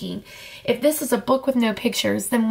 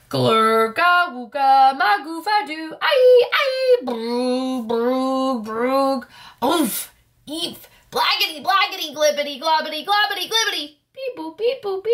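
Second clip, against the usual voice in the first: silly sounds; 3 semitones higher than usual. Pretend. Reader forced to make silly sounds, sung gibberish turning to beeps. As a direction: babble nonsense like a goofy cartoon. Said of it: "make silly sounds like".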